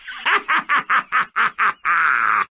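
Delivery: long gloating villain laugh; evil laugh. Acted. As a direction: cackle over the chaos you made.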